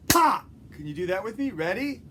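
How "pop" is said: sharp loud shout; loud pop. Shown as a demonstration. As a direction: shout it for the kids to copy. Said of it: "I want you to go".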